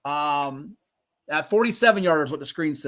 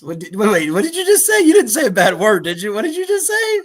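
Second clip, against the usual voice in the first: high, loud incredulous questioning; louder, higher, taken aback; startled questioning; 3 semitones higher, 7 dB louder than usual. Real, not acted. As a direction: demand a repeat in surprise. Startled questioning after misheard remark.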